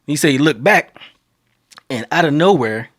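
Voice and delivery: normal talking voice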